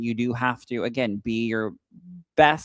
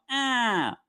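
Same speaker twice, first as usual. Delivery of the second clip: mocking tongue noise; 13 semitones higher than usual. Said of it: "he'll look and go".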